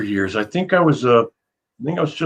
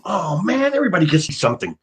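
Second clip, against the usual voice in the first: raised-pitch whine of envy, retold; mock left-out grumble; envious complaint; 5 semitones higher than usual. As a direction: moan that everyone else got luckier. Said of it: "and I'm".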